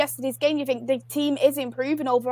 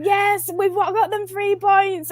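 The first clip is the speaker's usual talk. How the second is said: high, slow mock cheer; excited cheer; 7 semitones higher, slower than usual. Fan recalling her cheer at the save, high and drawn out. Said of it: "I was like".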